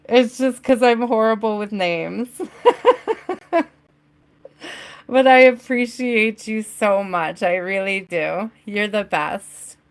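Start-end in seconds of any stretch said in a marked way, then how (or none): 2.3-3.6 high, quick giggle; real giggle. Real, not acted. Host horrible with names, high self-mocking giggle. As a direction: laugh at your own failing. Laughing at being bad with names.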